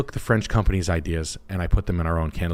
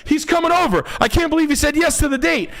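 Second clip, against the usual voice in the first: mock excited girl. Girl panicking that her date is coming over. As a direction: squeal like a giddy panicking girl. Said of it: "and you're like".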